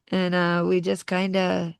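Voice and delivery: ordinary talk, nothing marked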